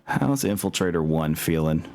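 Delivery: normal talking voice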